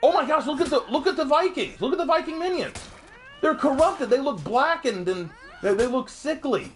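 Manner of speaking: high-pitched, thrilled discovery; amazed excitement. Real, not acted. Streamer spotting corrupted Viking minions, excited and high-pitched.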